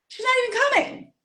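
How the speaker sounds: high, fast mocking voice; mock quote. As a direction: toss it off in a squeaky mocking tone. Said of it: "I'm like".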